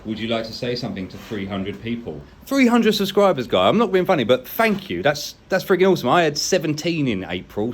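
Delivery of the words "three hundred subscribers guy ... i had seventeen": excited. Real, not acted. Celebrating three hundred subscribers.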